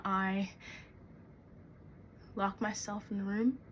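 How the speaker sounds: soft, low, halting delivery; soothing yourself in a quiet voice; calming herself. Acted. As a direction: settle into a hushed calm, with long pauses.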